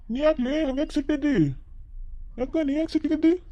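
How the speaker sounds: high-pitched, drawn into a query; puzzled questioning. Acted. Husband asking his sulking wife why she is angry, puzzled.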